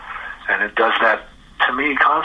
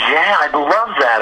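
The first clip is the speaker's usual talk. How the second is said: higher, slow, delighted yes; retold eager acceptance; 6 semitones higher, 3 dB louder, slower than usual. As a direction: imitate someone jumping at an offer.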